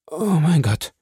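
dying Caderousse cries out to God; desperate prayer. A narrator's reading. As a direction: beg God for forgiveness. Dying man prays.

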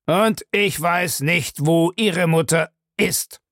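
slow, weighty stress on each syllable of "Und ich weiß nicht, wo Ihre Mutter ist"; every syllable stressed. A narrator's reading. Man denies knowing "wo Ihre Mutter ist", stressing every syllable slowly. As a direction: hammer out "ich weiß nicht" word by word, drawn out. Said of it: "Er betonte jede Silbe".

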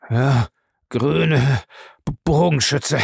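halting, broken stammer. A narrator's reading. Bellamy facing the Green Archer, stammering brokenly. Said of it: "stammelte Bellamy abgerissen".

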